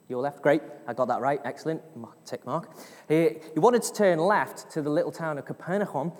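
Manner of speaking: ordinary delivery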